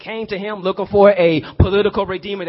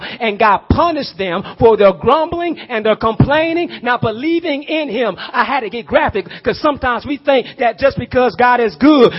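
ordinary talk

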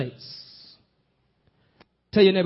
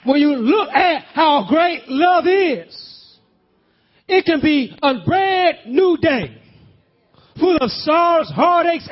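louder and higher, building hard; full-force preaching; 4 semitones higher, 4 dB louder than usual. Acted. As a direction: drive it home to the congregation.

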